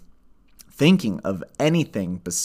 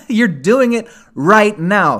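loud, high emphatic declaration; 10 semitones higher, 8 dB louder, slower than usual. Real, not acted. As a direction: drive the point home hard. Emphatic point repeated.

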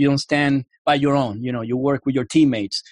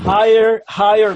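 louder, clearer name; emphatic repeat; 6 semitones higher, 5 dB louder, slower than usual. Real, not acted. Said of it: "what's the name of it again?".

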